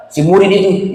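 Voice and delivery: ordinary talk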